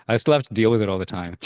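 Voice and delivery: plain reading voice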